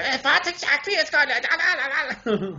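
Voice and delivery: high, slow, cartoonish German accent; Hollywood German accent. Shown as a demonstration. Demonstrating what most people are used to, hammed-up German accent. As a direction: overdo a movie-style German accent, pitched high. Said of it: "the Hollywood say".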